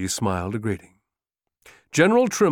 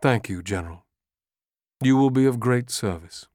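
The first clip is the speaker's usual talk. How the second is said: softly. Acted. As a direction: speak softly to comfort him. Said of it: "Lee said softly".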